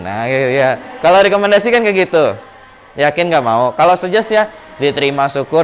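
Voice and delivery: ordinary talk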